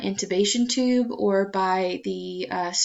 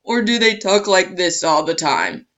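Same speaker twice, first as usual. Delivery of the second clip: lower, louder pushed-down voice; low pitch; 3 semitones lower, 5 dB louder than usual. Shown as a demonstration. Teacher demos a too-low voice to students, lower. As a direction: demo an abnormally low speaking pitch. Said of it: "a lower pitch or a higher pitch".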